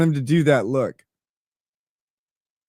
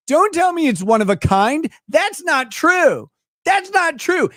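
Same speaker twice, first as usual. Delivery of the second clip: high-pitched, exasperated calling out of a lie; indignant outburst; 6 semitones higher than usual. Real, not acted. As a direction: protest in a high, incredulous voice. Mock outrage at streamer's claim.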